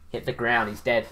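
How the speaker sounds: ordinary delivery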